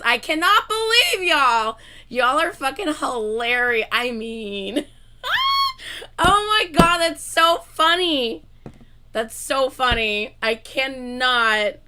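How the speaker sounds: loud, slow laughing disbelief; real loud laughing fit; yelling laughter. Real, not acted. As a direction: shout it out while cracking up. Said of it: "Oh, I am yelling".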